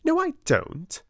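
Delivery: sharp high snap of denial; snapped. Acted. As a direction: bite it off, stung. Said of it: "snapped Lavinia".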